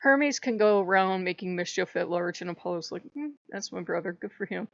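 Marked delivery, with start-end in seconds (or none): 3.1-4.7 indulgent shrug. Retold or imitated. Apollo shrugs about brother.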